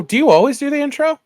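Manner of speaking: ordinary talk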